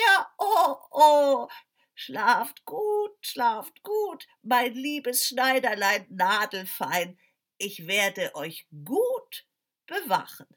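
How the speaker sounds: high, loud cackling scarecrow cries; croaking scarecrow. Acted. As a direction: crow and cackle like a giddy scarecrow. Scarecrow speaks.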